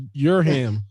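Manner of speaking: "your ham" as two words with a clear H; misread surname. Shown as a demonstration. Cohost teasing guest over his surname, misread with an H. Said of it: "I thought there was an H".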